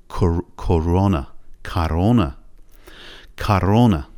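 کرونا with the Russian ک made in the back of the throat, mouth open; slow demo of کرونا with a back-throat ک. Shown as a demonstration. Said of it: "ک یه مقداری از ته حلق میاد". (تلفظ روسی کرونا با ک از ته حلق)

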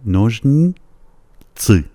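نوژنیتسی split slowly before the thick final tsi; word in two parts. Shown as a demonstration. Teacher helping beginners, نوژنیتسی in two slow parts. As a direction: say نوژنی, hold it, then تسی. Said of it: "شاید بد نباشه در دو بخش، کلمه رو ادا کنید".

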